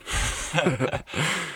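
high falling burst of laughter; laughing. Real, not acted. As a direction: let out a real laugh. Laughing at own joke.

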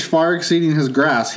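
ordinary delivery

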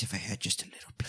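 quick breathy whisper; fast whispered plea. Retold or imitated. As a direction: whisper it, hurried and hushed. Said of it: "Loomis voice".

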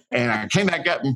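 normal talking voice

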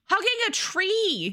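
incredulous. Real, not acted. Incredulous retelling of book scene.

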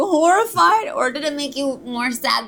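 high, loud mock horror; mock horrified. Shown as a demonstration. Explaining clowning, acting out a horrified reaction. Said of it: "are you delighted by it".

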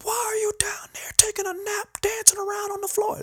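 imitating a drill sergeant's very high, strained yell; mocking, high-pitched drill instructor tirade. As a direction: bark at the recruit like an angry drill instructor. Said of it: "and he's like".

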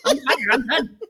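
retold refusal. Mother refusing to push for the doctor, loud comic protest. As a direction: refuse loudly, half laughing.

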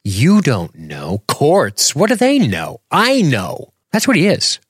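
high-pitched pompous tyrant; shrill mock of an arrogant doctor.

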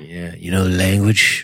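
slow seductive voice; slow and sultry, lightly voiced; sexy voice. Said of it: "That's [name]' sexy voice".

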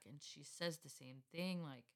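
usual speaking voice

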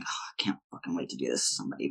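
hushed, fast inner thought; quiet inner voice. Retold or imitated. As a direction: mutter the thought quickly under breath. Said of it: "being an apprentice and thinking".

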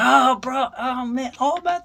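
high-pitched mock German exclamations; German accent. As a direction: do an excitable, hammed-up German accent. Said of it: "he's got that German accent".

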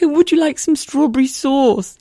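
high slow giggly explaining; high laughing. Retold or imitated. Wife explaining the sauce to Mike, high laughing. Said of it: "I was like".

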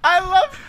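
squealing laugh; real giddy laughter; high laughing fit. Real, not acted. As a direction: crack up high and loud.

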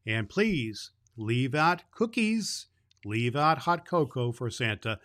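ordinary delivery, nothing marked

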